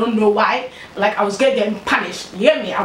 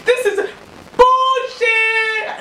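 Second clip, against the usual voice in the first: mock tearful wail, high and drawn out; retold crying protest; 12 semitones higher, 3 dB louder, much slower than usual. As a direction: wail it like a hammed-up crybaby. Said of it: "I start crying".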